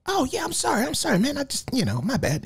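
high, meek mock apology; mock-timid high apology; apologetic guy. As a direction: squeak out a sheepish sorry. Retold apology of man backing up.

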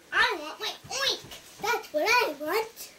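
high, loud piglet demand. Acted. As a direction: insist as a little storybook pig. Pig demands his oink back.

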